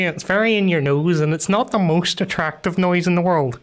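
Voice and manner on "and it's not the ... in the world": normal talking voice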